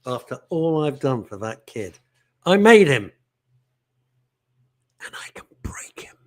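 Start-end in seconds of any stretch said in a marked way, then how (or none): 5.0-6.2 whispered threat, half joking; playful sinister whisper; mock menacing whisper. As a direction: hiss it like a movie villain, tongue in cheek. Mock menace about his son.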